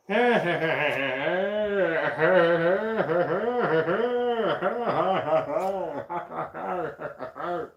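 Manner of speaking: very long rolling maniacal laugh; mad cackle. Acted. Laughing at speaking to no one, maniacal cackle.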